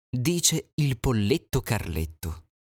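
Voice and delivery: ordinary delivery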